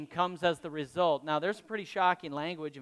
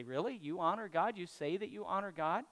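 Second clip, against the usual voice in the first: softer, rising doubtful questions; skeptical questioning; 9 dB quieter than usual. Retold or imitated. Preacher voices the world seeing inconsistency, softly skeptical. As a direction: quietly question it in mild disbelief.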